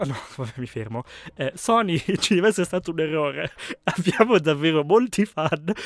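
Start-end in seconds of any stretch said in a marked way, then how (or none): 1.5-5.7 reading through bursts of laughter; cracking up mid-sentence; can't stop laughing. Real, not acted. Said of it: "non riesco a smettere di ridere".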